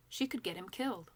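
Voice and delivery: usual speaking voice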